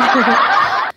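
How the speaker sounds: short amused laugh; real laugh. Real, not acted. Streamer joking with a chat friend about a botched massage, amused laugh. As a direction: laugh along with the teased viewer.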